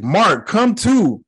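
high, drawn-out call to Mark. Retold or imitated. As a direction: call out slowly to rouse someone. Retelling his shouts at the screen.

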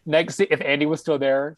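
ordinary talk

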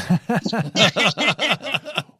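high, loud burst of laughter; laughing. Real, not acted. Co-host reacts to a joke about leaving, laughing hard.

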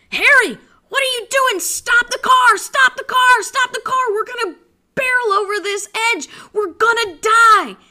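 high, loud panicked screaming; frantic wife yelling to stop; wife freaking out. Acted. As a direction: shriek it in acted terror. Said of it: "the wife is freaking out".

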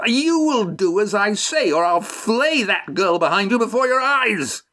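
put-on high-pitched scream of a thwarted child; screamed tantrum. A narrator's reading. Mufrid threatening Estrella, high tantrum scream. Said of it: "Mufrid screamed at Estrella like a thwarted child".

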